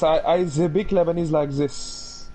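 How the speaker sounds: slow put-on Lebanese accent. Shown as a demonstration. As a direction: speak English with a Lebanese accent.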